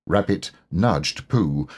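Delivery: usual speaking voice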